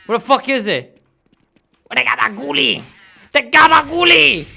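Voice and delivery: loud, high wiseguy yelling; mock mob rage. Gamer screams at enemies for the gabagool, loud and high. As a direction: rage like a mobster.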